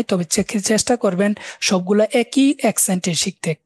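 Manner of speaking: normal talking voice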